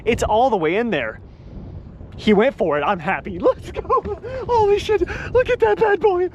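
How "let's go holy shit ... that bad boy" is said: high, thrilled exclamations; excited. Real, not acted. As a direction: cheer at the catch. Landing the fish.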